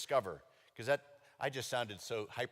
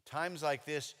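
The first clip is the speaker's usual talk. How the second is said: usual speaking voice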